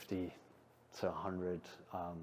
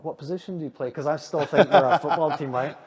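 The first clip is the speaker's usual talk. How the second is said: excited questioning; 6 semitones higher, 12 dB louder than usual. Retold or imitated. Puzzled questions to Scottish ravers.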